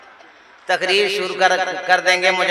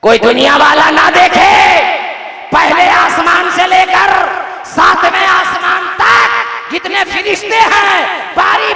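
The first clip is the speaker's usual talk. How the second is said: rousing sermon peak, much higher and louder; full-force preaching; 9 semitones higher, 4 dB louder than usual. Acted. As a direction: preach it at the top of your voice like a joyful proclamation. Preaching climax on angels.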